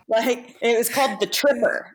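chuckling through the title, pitch up; laughing. Real, not acted. Laughing to the host about the movie title, amused. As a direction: laugh as you name the silly title.

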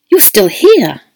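loud relieved exclamation; startled, relieved cry at the door; exclaimed. A narrator's reading. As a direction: burst out with relief at seeing her. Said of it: "he exclaimed".

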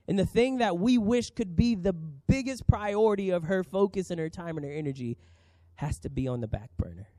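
usual speaking voice

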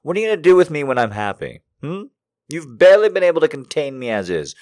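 high, loud, fast teasing rant; excited taunting. Acted. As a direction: fire it off, giddy and high.

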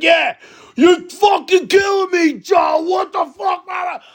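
imitating a neighbor's high-pitched yell; mock an irate neighbor screaming down the phone. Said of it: "what are you doing screaming and yelling at me for".

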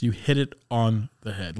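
normal talking voice

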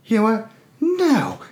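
very high squeaky sudden no; mock Rose refusal. Rose bails from the lifeboat, squeaky high refusal. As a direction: pitch it way up in mock outrage.

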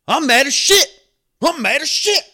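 high, loud hammed-up outburst; mock angry shout. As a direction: yell it like a furious man being imitated. Angry man's retort, retold.

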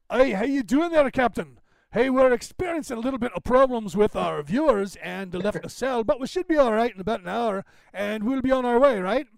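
casual captain's disguise, English accent lightly voiced; retold fake accent. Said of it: "he speaks with an English accent".

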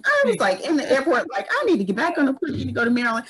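emotional gush, fast and high-pitched; overwhelmed, high and fast. Real, not acted. Telling her friend how she got overwhelmed at the airport, voice jumping high and fast. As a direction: voice jumps high, rushing out the words. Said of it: "I start crying".

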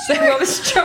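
breaking into high laughter; laughing. Real, not acted. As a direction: talk through a fit of giggles. Laughing about the yawn.

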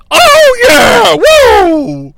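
high, loud whooping; hammed-up wrestling hype scream; excited cheering. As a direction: let out a long wild holler. Acts out excited commentator cheering.

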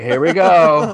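toast as if settling in to drink; mock cheer.

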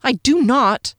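high, indignant denial; pleading insistence; anxious protest. A narrator's reading. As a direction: protest as a frightened captive. Captive protests the accusation.

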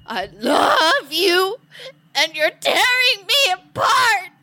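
crying. Acted. Melodramatic alien lover quarrel.

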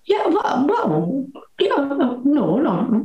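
high, halting mock fluster; flustered reply. Actress to producer questioning her accent, flustered.

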